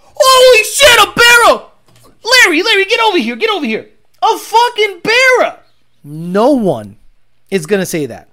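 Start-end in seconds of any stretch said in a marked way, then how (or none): 0.0-5.6 high, loud, overexcited shout about the car; hammed-up gearhead squealing at a Barra; mock excited onlooker. Mocking a car fan yelling to his friend Larry, high and loud. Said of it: "No one's gonna go".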